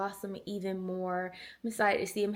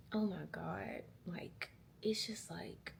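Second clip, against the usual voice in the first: whispered, much softer aside; hushed whisper; 9 dB quieter, slower than usual. Real, not acted. Military wife confiding about civilian life, whispered. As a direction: drop to a whisper.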